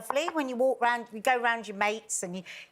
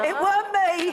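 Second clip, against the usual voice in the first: mock denial; 7 semitones higher, 6 dB louder than usual. Jokey denial about the found ashes.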